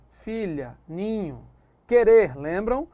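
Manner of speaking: usual speaking voice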